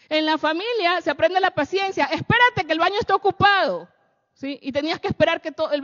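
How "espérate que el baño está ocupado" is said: shouting through the door. Retold or imitated. Familiar grita desde el baño.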